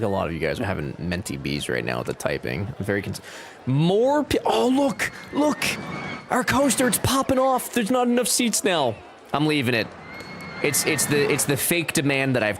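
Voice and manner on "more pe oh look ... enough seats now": high, excited squeal over the coaster. Real, not acted. Streamer spots the crowded coaster, thrilled and high-pitched. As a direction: burst out thrilled, voice way up.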